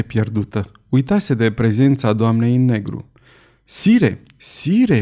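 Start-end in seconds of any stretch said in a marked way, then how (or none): 3.6-5.0 high, half-raised cry of Sire; blurting it out almost aloud in awe; a startled exclamation that she can't hold back; nearly aloud. A narrator's reading. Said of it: "făcu ea aproape cu voce tare".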